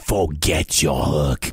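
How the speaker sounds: very low giant rumble; decide it in a deep brute's voice; threatening giant. Acted. Giant's line in the story.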